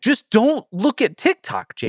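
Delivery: slow, high, exasperated plea; just tell him, drawn out and fed up; high, slow exasperated groan at a friend. Real, not acted.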